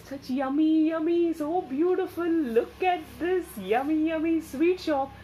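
high, bright gushing over the sweets; delighted. Acted. Storyteller showing children the sweet shop, delighted gushing. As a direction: ooh and aah like a child at a candy window.

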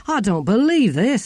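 high, loud protest; exasperated disbelief. Acted. Ern outraged at sharing secret.

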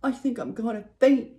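plain reading voice

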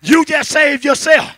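loud preaching cadence, every phrase pushed; full-force preaching. Acted. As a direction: drive it home to the church. Preacher driving the sermon loud.

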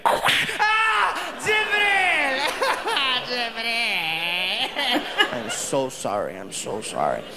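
mock groveling apology. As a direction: beg forgiveness in a hammed-up panic. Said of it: "I'm like".

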